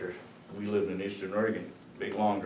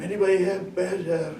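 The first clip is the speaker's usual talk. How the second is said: higher, louder pleading calls; hawking clean urine for ten bucks, raised voice; retold bathroom yelling; 10 semitones higher, 5 dB louder than usual. Draftees calling out across the bathroom, higher and louder. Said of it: "The guys in there screaming in the bathroom".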